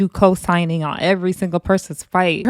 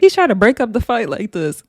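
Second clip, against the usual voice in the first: ordinary talk, nothing marked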